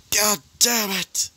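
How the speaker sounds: high strained cry of pain, a little slow; scream in pain. Acted. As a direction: cry out as if being beaten down. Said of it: "He would scream out in pain".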